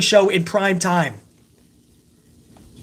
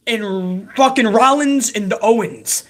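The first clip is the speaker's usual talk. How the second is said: ordinary delivery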